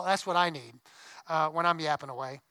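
normal talking voice

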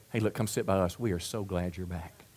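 low, soft, kindly invitation; acted gentle welcome. Church member welcoming back a backslider, soft and low. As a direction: welcome them back quietly, voice sinking.

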